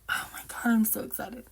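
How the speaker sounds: breathless unvoiced whisper of delight; excited whisper. Real, not acted. Whispering to viewers over grandpa's reunion, thrilled.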